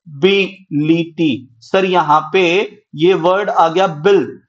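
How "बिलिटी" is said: slowly sounded out. Shown as a demonstration. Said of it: "teacher sounds out syllables".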